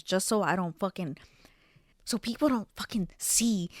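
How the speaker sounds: ordinary talk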